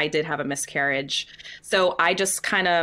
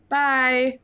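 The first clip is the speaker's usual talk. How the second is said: awkward chirpy goodbye; 7 semitones higher, 4 dB louder than usual. Retold or imitated. Leaving embarrassing audition.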